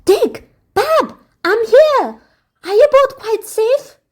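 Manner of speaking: a sister anxious for her siblings; eager cry. A narrator's reading.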